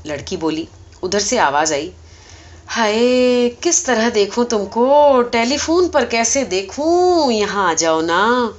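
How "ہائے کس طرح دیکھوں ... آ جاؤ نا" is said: lovesick sigh. Acted. Superintendent pines for the operator over the phone, slow and swooning. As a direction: moan it like a smitten fool.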